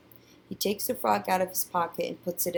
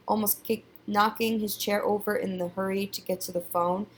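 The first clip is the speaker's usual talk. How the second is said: usual speaking voice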